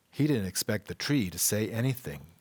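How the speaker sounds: ordinary delivery, nothing marked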